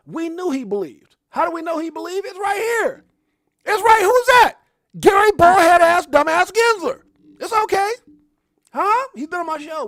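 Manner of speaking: loud, high hype yelling; excited livestream shouting; hyped-up shout. Real, not acted. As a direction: fire up the crowd at the top of your lungs. Hype rant about Ripple believers.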